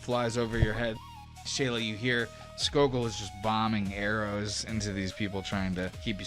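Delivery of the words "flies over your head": normal talking voice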